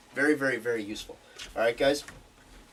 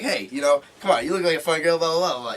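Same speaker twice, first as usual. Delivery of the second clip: playful banter; 3 semitones higher, 6 dB louder, faster than usual. Shown as a demonstration. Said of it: "It matters how I say it".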